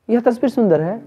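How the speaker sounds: high, rising note of amazement; surprise. Shown as a demonstration. Teacher demonstrating intonation to class, astonished. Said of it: "विस्मय कर".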